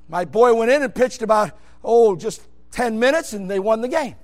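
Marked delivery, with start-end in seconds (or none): none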